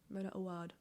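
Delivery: Awad ending in an open "wahd"; trying a pronunciation. Shown as a demonstration. Reader repeating the author's name to test it, trying a pronunciation. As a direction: stretch the last vowel of Awad to "ah". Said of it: "pronounce her name with my accent".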